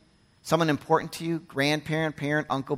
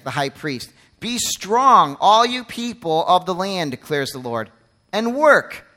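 forceful scripture reading; 5 dB louder than usual. Acted. Pastor reads God's charge to the people, loud and firm. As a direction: declaim the prophet's words to the congregation.

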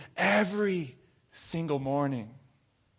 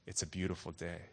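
low, hushed address to God; quiet, intimate prayer, dropping away at the end; prayerful; 5 semitones lower, 6 dB quieter than usual. Shown as a demonstration. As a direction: say it softly as a small prayer. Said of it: "to a place of prayer".

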